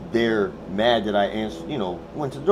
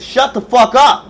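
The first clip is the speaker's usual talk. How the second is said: high, very loud, slow shout; furious yell; 12 semitones higher, 16 dB louder, slower than usual. Retold or imitated. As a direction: yell each word out at the neighbour. Yelling at neighbour at the door.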